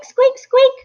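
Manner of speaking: two tiny high-pitched hamster squeaks. A narrator's reading. Hamster squeaking to a girl who can't understand him. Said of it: "All she heard was squeak".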